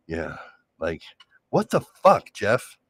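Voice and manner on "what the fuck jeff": sudden high yelled curse, playful; mock angry outburst. Mock yell at Jeff for tone. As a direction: bark it like a fed-up partner. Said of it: "Like, stuff like that".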